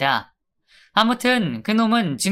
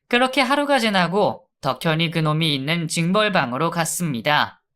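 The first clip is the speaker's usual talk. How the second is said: plain reading voice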